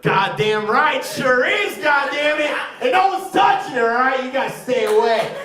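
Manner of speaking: loud, high, hammed-up possessive bluster; mock-protective shouting. Comic cheering husband guarding his wife, mock-protective shout. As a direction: holler a warning to the whole room.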